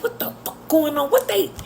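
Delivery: imitating a fired-up union steward; indignant outburst. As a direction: blurt it out, outraged. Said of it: "he would be in the meetings cussing".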